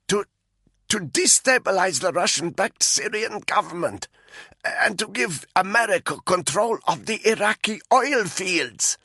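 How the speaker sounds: a frightened prisoner talking under a rope; Malinka's voice. A narrator's reading.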